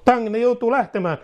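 high, forceful preacher's cry; fiery preaching. Real, not acted. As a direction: proclaim it with full force.

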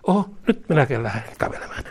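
quick, laughing aside; laughing joke. Acted. Preacher joking to congregation, laughing fast. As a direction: laugh as if you'd get up too.

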